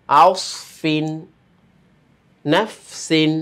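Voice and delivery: word-by-word recitation to students; each word read out separately for pupils to repeat; slow classroom reading, a pause after every word; reading aloud. Shown as a demonstration. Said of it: "এখন আমি বানান ছাড়া একটু পড়ে দিই".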